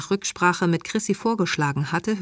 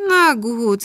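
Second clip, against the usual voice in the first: loud, higher-pitched sigh of giving in; 6 semitones higher, 6 dB louder than usual. A narrator's reading. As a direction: sigh it out as the mother relents. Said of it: "seufzte Chrysula".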